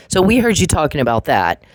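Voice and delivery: ordinary talk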